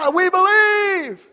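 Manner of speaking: high, slow, full shout; meaning it. Shown as a demonstration. Preacher urging congregation to declare faith, slow high shout. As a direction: shout it out like you mean it. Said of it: "if you mean it".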